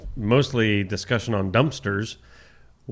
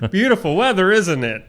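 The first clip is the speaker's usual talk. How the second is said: loud, high, jolly neighborly holler; mock over-friendly shout that eases off into small talk; Neil; 9 semitones higher, 5 dB louder than usual. Co-host imitating a neighbor's greeting, hammed-up and loud. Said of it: "Neil's the one like".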